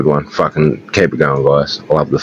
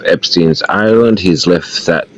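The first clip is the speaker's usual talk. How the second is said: put-on official caller in an American accent; formal FBI agent, put-on American accent.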